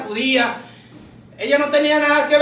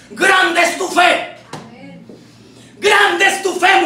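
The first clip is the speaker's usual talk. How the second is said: slow, raised, ringing proclamation; exclaimed praise; 4 semitones higher, much slower than usual. Acted. Jesus acclaiming the woman's faith, exclaimed praise.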